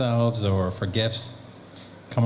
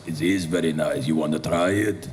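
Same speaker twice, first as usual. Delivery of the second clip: Andre voice; 6 semitones lower than usual. Retold or imitated. Andre the Giant tempting the actor to ride, deep and coaxing. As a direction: lower your voice and tempt him. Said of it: "He goes".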